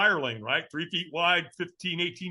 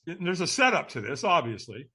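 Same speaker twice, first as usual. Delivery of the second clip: usual speaking voice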